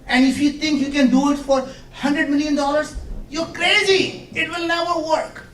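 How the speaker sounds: high, loud know-it-all telling you it can't be done; dismissive expert voice. Acted. Experts dismissing the cheap moon mission, high loud scoffing.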